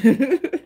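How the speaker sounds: a short high laugh breaking through tears; teary laugh. Real, not acted. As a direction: laugh at yourself while crying.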